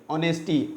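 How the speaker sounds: ऑनेस्टी said softly with a silent h; quiet model ऑनेस्टी with a silent h; correct honesty. Shown as a demonstration. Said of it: "तो ऑनेस्टी बोलेंगे".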